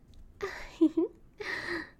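high, loud playful giggle after a naughty joke; teasing laugh. Acted. Girlfriend laughing at her own teasing joke to boyfriend. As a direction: laugh it off, caught being cheeky.

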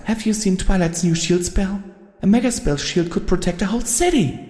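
high, far-off voice of a timid pony; small high confession. A narrator's reading. Timid pony confesses to her friend, high and small. Fluttershy explains her plan.

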